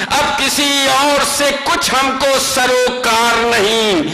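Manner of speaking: rising, declamatory mushaira recitation; stately nazm recitation. Acted. Poet recites nazm stanza. (پرجوش مشاعرے کی نظم خوانی)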